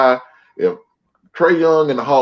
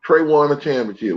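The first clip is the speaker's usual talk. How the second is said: ordinary talk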